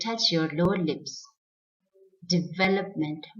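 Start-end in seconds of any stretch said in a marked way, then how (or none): 2.3-3.2 Development as di-VEL-up-ment; correct pronunciation. Shown as a demonstration. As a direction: clear toothy V in Development. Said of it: "it's development".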